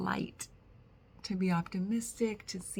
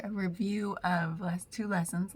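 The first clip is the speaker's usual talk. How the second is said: usual speaking voice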